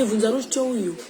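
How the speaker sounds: ordinary talk, nothing marked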